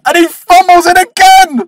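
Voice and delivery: high, loud hammed-up outburst; exasperated yell. Reviewer reacts to hero wasting last chance, exasperated yell. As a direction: yell it like a despairing fan.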